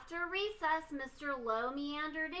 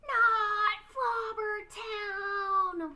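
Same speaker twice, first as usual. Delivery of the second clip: loud, high, drawn-out cry of dread; horrified shout; 7 semitones higher, 7 dB louder, much slower than usual. Acted. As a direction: wail the name in horror. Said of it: "we shouted".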